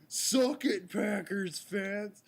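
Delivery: loud, high, drawn-out taunt; crowing sports-fan jeer; gloating fan yell. Retold or imitated. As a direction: shout it like an obnoxious rival fan. Voices a gloating rival fan.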